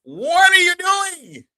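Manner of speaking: very high, loud, slow stretched taunt; young brothers' mocking call. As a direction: mimic the young guys, drawing it out. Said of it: "As young brothers say".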